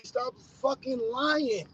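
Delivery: ordinary talk, nothing marked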